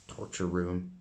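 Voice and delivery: usual speaking voice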